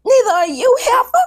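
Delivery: high, loud sassy retort; indignant outburst. Real, not acted. Calling a castmember out.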